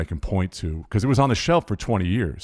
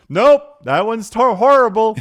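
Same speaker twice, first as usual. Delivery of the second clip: much higher and louder, hammed-up dismissal; blunt wife's verdict; 14 semitones higher, 8 dB louder, slower than usual. Wife rejects a new melody, loud mock verdict. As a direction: shoot the song down with loud mock disgust. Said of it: "She'd go".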